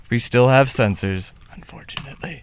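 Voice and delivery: whispered grumble; hushed aside. Real, not acted. Host admits to co-host that censors remain, whispered aside. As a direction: drop to a whisper, fading away.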